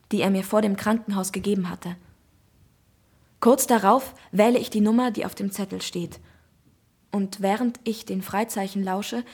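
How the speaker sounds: usual speaking voice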